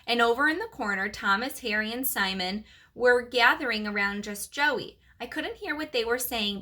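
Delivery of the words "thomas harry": ordinary delivery, nothing marked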